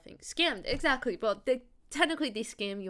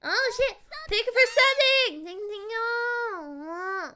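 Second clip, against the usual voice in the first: high, loud thrilled outburst; real excitement, much higher and louder; excited squeal; 13 semitones higher, 8 dB louder, slower than usual. Real, not acted. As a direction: shriek with delight at the sub. Reacting to a new sub.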